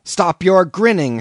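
loud angry high shout. A narrator's reading. Narrator shouting at the grinning landlord. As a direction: yell at him to stop. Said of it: "shouted I".

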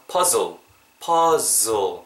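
"퍼즐" as English "puzzle" with a buzzing z; exaggerated puh-zzzol for "퍼즐". Shown as a demonstration. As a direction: stretch the zz in "퍼즐", slowly. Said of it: "이게 즈 발음이거든요".

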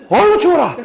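high, loud burst imitating someone freezing; mock cold exclamation. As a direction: cry out in exaggerated complaint at the cold. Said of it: "이러시는 거예요".

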